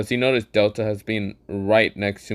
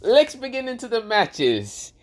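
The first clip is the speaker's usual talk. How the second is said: high, slow showman voice; Gojo voice; 8 semitones higher than usual. Acted. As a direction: play the cocky sorcerer, pitched up. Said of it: "Gojo says".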